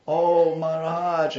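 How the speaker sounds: high, loud, long-drawn mock wail; imitated crying mother. Mother appealing to the guru, imitated wailing. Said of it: "and she starts crying".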